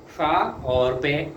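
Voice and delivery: slow dictation voice; slow letter-by-letter spelling. Shown as a demonstration. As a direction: spell it out patiently for students. Teacher spells out shorthand letters.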